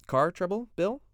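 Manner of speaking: a breezy neighbourly question that hides nerves; forced casual. Acted. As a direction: play it casual while worried. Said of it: "as casually as he could".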